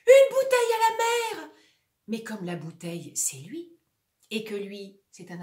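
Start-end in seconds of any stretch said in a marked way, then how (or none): 0.1-1.5 high, loud distress cry; shout. Acted. Drifting actor crying out message in a bottle. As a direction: cry it out like a signal. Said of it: "Il a envie de crier".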